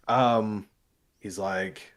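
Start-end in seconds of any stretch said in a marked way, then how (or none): none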